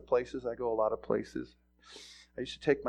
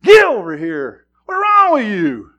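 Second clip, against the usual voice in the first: slow, loud and much higher yell; 9 semitones higher, 19 dB louder, slower than usual. Retold or imitated. Church members yelling at a straying member, loud. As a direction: holler at the straggler. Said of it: "You guys are yelling at 'em".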